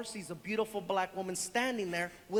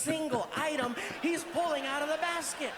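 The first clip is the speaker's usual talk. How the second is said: normal talking voice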